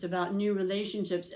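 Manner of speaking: ordinary talk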